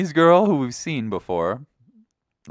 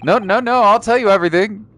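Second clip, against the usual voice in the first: hammed-up frightened pleading; acted panic; 3 semitones higher, 5 dB louder, faster than usual. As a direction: blurt it out fast to placate a threat. Game hero threatened, gives in.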